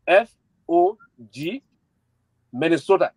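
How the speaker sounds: slow, letter by letter; reading slowly. Shown as a demonstration. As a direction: spell each letter out slowly for listeners. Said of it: "I will read the Cash App number slowly".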